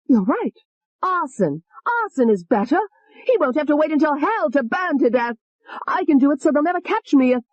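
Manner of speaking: high, venomous female alter; high hateful young woman; vicious Tony. Acted. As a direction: sneer the threat up high. Tony's voice.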